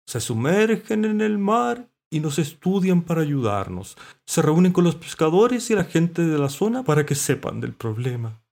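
high, loud character voice; earnest mollusc. A narrator's reading. Mollusc explaining the scientists' work, high and loud.

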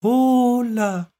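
very high, loud, drawn-out greeting; underwater mollusc voice. A narrator's reading. Mollusc answering from inside the water, high and stretched. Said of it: "una voz desde el interior del agua".

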